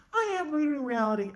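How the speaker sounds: high-pitched imitation of her protest; hammed-up, high indignant woman; mock protest.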